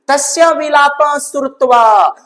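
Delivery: loud, high declaiming of the Sanskrit; teacher declaiming the Sanskrit line loudly to students; loud recitation. Acted. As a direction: proclaim the verse to the class.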